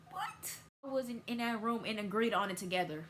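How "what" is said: high squeak of disbelief; surprised what. Retold or imitated. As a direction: play dumb, mock shock. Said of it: "I was like".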